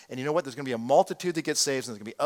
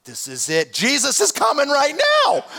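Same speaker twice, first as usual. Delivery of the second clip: excited; 10 semitones higher, 5 dB louder than usual. Retold or imitated. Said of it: "I thought".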